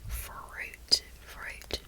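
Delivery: whispered Australian "fruit" with emphasized R; Australian "fruit", R stressed and U deep; Australian accent. Shown as a demonstration. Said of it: "You can actually emphasize the R sound".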